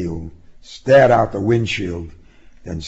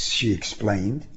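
plain reading voice

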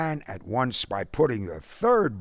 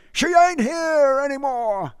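high, loud choked wail; choked cry; 7 dB louder than usual. Acted. Father cries to the suitor that his daughter is gone. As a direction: cry it out, voice catching. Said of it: "Rochford cried in a choked voice".

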